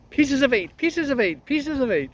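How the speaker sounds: parrot voice. Acted. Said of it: "How's that for a parrot voice?".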